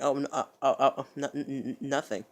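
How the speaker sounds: loud, stuttering denial; flustered stammer. Retold or imitated. Caught out by Stephanie's question, flustered stammering. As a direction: trip over the word as if caught out. Caught out, denies saying anything.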